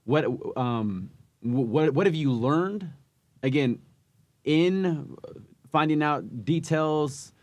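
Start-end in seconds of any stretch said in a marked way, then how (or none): none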